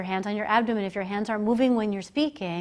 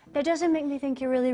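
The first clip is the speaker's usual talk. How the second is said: ordinary delivery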